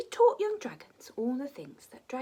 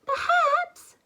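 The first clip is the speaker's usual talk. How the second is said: put-on high, loud bright girl voice; princess Pearl; 10 dB louder than usual. Princess Pearl offering herself to Zog, high and loud. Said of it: "she said".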